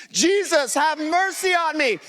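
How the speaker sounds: put-on high shout with the kids; preacher leading a crowd shout; group yell. Shown as a demonstration. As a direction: lead the room in a yell. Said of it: "I want you to yell with me".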